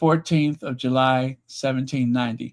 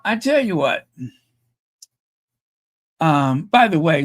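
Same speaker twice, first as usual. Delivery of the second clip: normal talking voice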